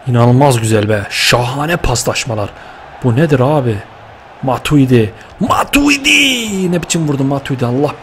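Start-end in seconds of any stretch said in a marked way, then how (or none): 5.4-6.7 drawn-out goal call. Real, not acted. Gamer celebrating his scorer, long high stretched "Matuidi".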